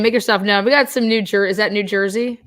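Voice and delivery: ordinary talk, nothing marked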